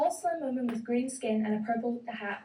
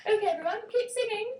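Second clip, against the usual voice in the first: bluebird conductor; 11 semitones higher than usual. Acted.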